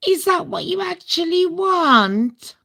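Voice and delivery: high, sweetly doubting question; mocking quote. Imitating the maid of honor with a high, sweetly doubting question. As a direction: mimic her fake-caring question. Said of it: "was met with".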